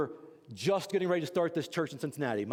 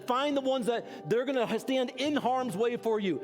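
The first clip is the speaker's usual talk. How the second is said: raised, pressing pulpit exhortation; preaching at full force; 4 semitones higher than usual. Acted. Pastor urging church to find covenant friends, preaching hard. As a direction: push the congregation to act, higher.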